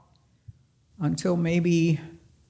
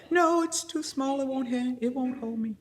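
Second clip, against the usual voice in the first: much higher, whimpering no; stuck on the rock, whining back at her friend; tearful refusal; 8 semitones higher than usual. Retold or imitated. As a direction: plead like a scared crying child. Frozen climber refusing, crying.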